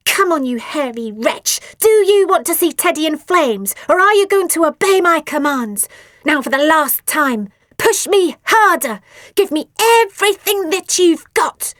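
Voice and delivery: high, loud bossy yelling; bullying command. A narrator's reading. Asti bullying Phoebe to push.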